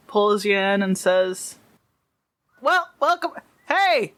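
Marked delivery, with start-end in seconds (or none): 2.6-4.1 hearty fatherly summons; dad calling his kids. Acted. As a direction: call out to the kids in a singsong rise and fall. Game master voices dad calling kids.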